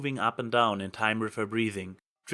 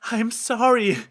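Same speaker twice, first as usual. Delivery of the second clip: high, loud pleading apology; desperate begging; 11 semitones higher, 7 dB louder than usual. A narrator's reading. Trixie begging the accusers, high desperate plea. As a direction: beg for mercy. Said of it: "Trixie begged".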